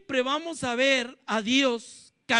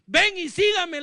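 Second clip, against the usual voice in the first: higher, louder call to follow; commanding call; 8 semitones higher, 6 dB louder than usual. Acted. Jesus calling a man to follow him, loud. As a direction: call out to the disciple with authority.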